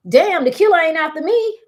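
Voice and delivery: laughing through a nervous thought, high and loud; half-laugh the words out as if the fear is silly. Retold or imitated. Anxious at scary movie, laughs.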